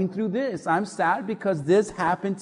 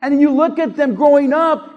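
preaching at full force; 5 semitones higher, 8 dB louder than usual. Real, not acted. Fired-up preaching.

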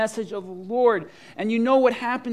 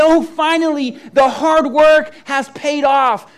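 loud, high cry of relief, slow at the end; triumphant relief; 6 semitones higher, 11 dB louder, slower than usual. Pretend. Church staff celebrating a huge revival, jubilant cry. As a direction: let the joy burst out. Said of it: "and we say".

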